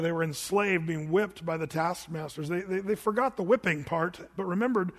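usual speaking voice

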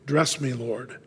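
softly yearning plea; hushed, longing prayer. Acted. The narrator asks the Lord softly and longingly. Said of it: "dear yearning in my voice".

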